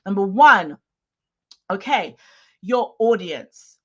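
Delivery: normal talking voice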